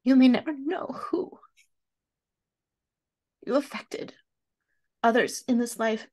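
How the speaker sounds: tearful, barely whispered start; reading through tears, voice catching; choked up reading. Real, not acted. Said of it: "Oh gosh, I can't see".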